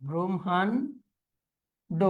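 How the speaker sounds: Brahmanda very slowly as "bromhan ... do"; broken into parts. Shown as a demonstration. Teacher dictating to students, slowly in parts. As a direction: hold back the last syllable of Brahmanda.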